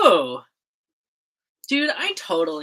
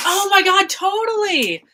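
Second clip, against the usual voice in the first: excited; 13 semitones higher, 6 dB louder than usual. Real, not acted. Excited agreement over chat.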